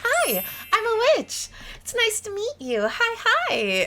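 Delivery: high-pitched, eager little hello; friendly witch greeting. Acted. Witch introducing herself to a visitor, high eager greeting. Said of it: "I'm kind of the, like".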